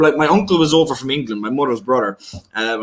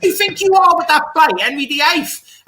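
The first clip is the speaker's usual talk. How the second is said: high, loud angry Irish mum yelling; mother's furious shout; 5 dB louder than usual. Retold or imitated. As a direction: scold him like an outraged mother at the door. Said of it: "I'm brilliant at impressions too".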